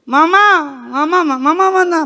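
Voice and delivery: put-on high, loud child's call; child calling mama. Child waking alone looking for mother, calling out. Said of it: "pertama kali dia panggil siapa".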